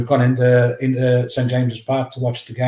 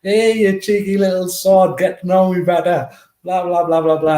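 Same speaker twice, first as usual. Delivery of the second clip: high, louder mock telling-off; scolding Brian Clough; 7 semitones higher, 4 dB louder than usual. Manager Brian Clough scolding the cheeky eleven-year-old, high mock voice. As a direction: tease the cheeky kid like the gruff manager. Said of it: "And he's like".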